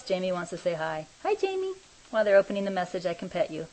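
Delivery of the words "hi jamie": pet talk. Real, not acted. Greeting her pet Jamie in high baby talk. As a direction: coo a hello to the animal.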